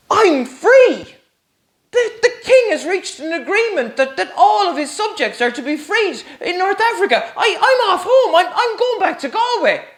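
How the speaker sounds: freed slave bursting with joy to his master; overjoyed. Acted. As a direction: laugh out the news of freedom. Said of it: "I'm getting too excited myself".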